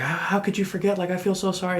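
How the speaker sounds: high, fretting wife voice; imitating freaked-out wife. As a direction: mimic her panicked sympathy, pitched high. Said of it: "Lynn was freaking out".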